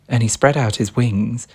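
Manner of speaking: plain reading voice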